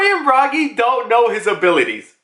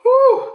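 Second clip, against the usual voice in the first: relieved whew; 4 dB louder than usual. Retold or imitated. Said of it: "he's just like".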